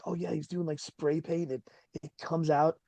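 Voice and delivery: a lightly voiced prop guy pitching a gag, hushed; hushed mock pitch. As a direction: say it under your breath, casually. Imitating a crew member's idea.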